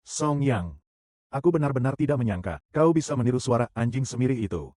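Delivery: normal talking voice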